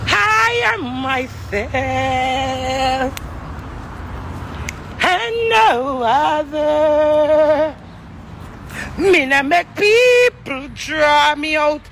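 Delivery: slow, drawn-out defiant declaration. Real, not acted. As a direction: stretch each word out proudly. Declaring self-worth, drawn out.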